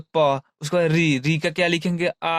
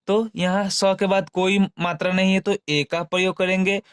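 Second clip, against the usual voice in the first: usual speaking voice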